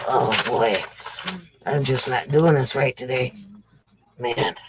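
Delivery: low, soft, winded grumble; short of air and discouraged; out of breath. Real, not acted. Comedian to self about a faltering show, out of breath. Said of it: "trying to get my breath going".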